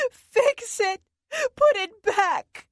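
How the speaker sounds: high, loud tearful demand, slowing at the end; crying demand. Acted. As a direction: Siobhan begging Dorothy to restore it, crying loudly. Siobhan demands the bond back.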